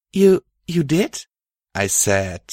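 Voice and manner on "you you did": higher, louder stammering disbelief; surprised. Acted. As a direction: caught off guard. Surprised Star.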